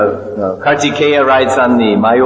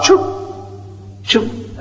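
choop offering sound; 7 semitones higher, 6 dB quieter than usual. Shown as a demonstration. Offering into sacrificial fire.

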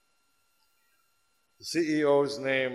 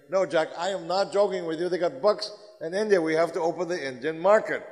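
insistent pitch; 6 semitones higher, 6 dB louder than usual. Acted. John insisting to boss Jack.

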